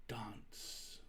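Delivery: breathy whispered command; whispered order. A narrator's reading. Baker whispering a command to her gingerbread man. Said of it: "I ordered it".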